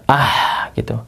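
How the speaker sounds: "ah" breathed out, nearly whispered; breathy sukun h. Shown as a demonstration. Teacher demonstrating the sighing h, breathy "ah". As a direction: sigh out "ah" on pure breath. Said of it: "itu desahannya ya".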